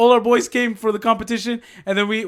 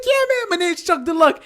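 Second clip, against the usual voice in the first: higher, put-on proud street voice; imitating Chuck; 8 semitones higher than usual. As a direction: say it like it's a famous brand. Chuck confirming his name.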